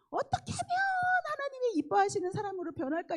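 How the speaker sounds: very high, wailing plea; burning yearning. Retold or imitated. Wanting to become someone God loves, yearning cry. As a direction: act out an inner longing boiling over. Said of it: "이거가 이 안에서 막 들끓으니까".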